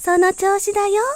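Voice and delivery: very high loud cheering. A narrator's reading. Sun, rain, wind and bird cheering the bud, very high loud cheers.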